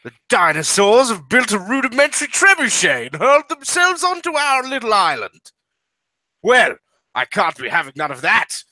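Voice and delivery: high-pitched British officer, indignant; World War One British accent. Acted. British man finds dinosaurs on his island, indignant British accent. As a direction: declaim it as a wartime Brit. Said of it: "British accent".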